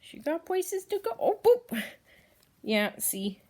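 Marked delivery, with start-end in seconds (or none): 0.0-1.1 much higher cooing pet talk. Real, not acted. Cooing at hedgehog. 1.2-1.8 much higher, louder squeak; high playful boop; cute boop. Real, not acted. Booping the hedgehog.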